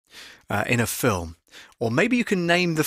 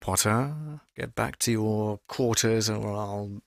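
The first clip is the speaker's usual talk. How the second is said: soft, slow, lower and quieter Snape-like menace; softly spoken threat to Potter; Alan Rickman; 4 semitones lower, 5 dB quieter, slower than usual. Retold or imitated. Vlogger quietly imitating Rickman threatening Potter. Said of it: "very kind of softly spoken".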